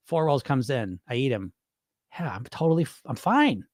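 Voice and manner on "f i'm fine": ordinary delivery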